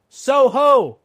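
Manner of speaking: sung-out high holler, very loud; roaring shout. A narrator's reading. Guard challenges approaching rider, roaring shout. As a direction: roar it as loud as possible. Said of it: "sang out, as loud as he could roar".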